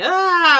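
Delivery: high loud mock scream of kids. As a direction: shriek it like panicked kids. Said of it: "the kids are all screaming".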